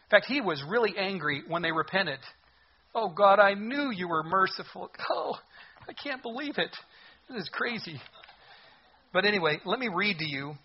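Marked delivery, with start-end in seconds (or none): 2.9-8.0 high, whiny complaint at God; mock exasperated Jonah. Jonah whining to God that he is merciful, exasperated. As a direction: sulk to God that he knew it. Jonah griping at God's mercy.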